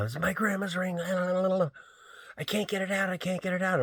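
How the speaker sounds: mock the frantic girl in a high voice; panicked friend. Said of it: "She was freaking out".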